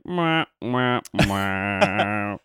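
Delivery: sad trombone mwah mwah; deflated letdown noise, dropping low; mocking blah blah. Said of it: "and then it was like".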